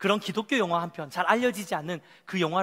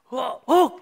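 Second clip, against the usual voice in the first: acted nervous gasps, higher and louder; trembling; 4 semitones higher, 6 dB louder, much slower than usual. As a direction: play Peter trembling at a first step. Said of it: "베드로가 막 떨면서". (떠는 척 놀란 소리)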